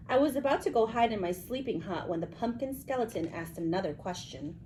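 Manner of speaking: plain reading voice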